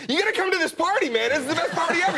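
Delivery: a partygoer who can't contain himself; overexcited partier. Retold or imitated. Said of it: "somebody keeps running in going".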